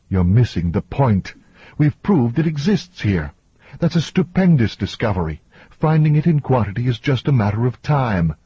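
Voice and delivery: lightly acted woman standing her ground; insistent woman scientist. Voices Victoria arguing back.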